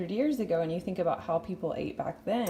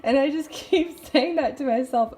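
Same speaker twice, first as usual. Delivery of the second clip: laughing; 7 semitones higher, 7 dB louder than usual. Real, not acted. Laughing at her kooky saying.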